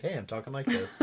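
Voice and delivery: easy, conversational imitation at an everyday pace; Kenny, natural. Imitating a groom who seems natural, relaxed and casual. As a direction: say it casually, as if just chatting. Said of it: "Kenny seems natural".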